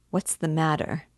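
usual speaking voice

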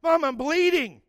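put-on high, loud child's wail; bleeding boy calling to his mom, high and loud; hurt kid crying out. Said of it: "son voiced in story".

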